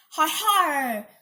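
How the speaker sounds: pirate gloat, har har; smug, hammed-up pirate laugh. A narrator's reading. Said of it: "she gloated".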